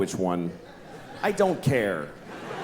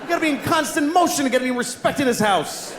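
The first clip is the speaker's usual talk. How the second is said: panicked flushed fish; 9 semitones higher than usual. Retold or imitated. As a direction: yell like a fish woken mid-nap. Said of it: "it looks like he goes".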